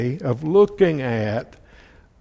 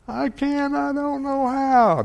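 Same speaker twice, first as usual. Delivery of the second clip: put-on high whining child voice, much higher; mock child whining it's too hard; whiny child; 10 semitones higher than usual. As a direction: whine like a kid who won't try. Child voiced refusing to tie shoes.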